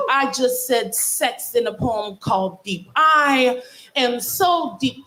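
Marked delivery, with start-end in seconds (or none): 3.0-5.0 very slow, drawn-out refrain; slow mock profound. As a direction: savour "so" like a sermon. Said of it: "in this tone of voice".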